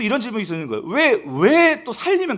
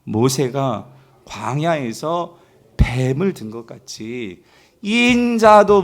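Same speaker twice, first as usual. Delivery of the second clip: very slow, measured scripture reading; leading unison reading; 7 semitones lower, 3 dB louder, much slower than usual. Real, not acted. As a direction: read out slowly so everyone can join. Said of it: "같이 읽어볼게요". (함께 읽는 느린 성경 봉독)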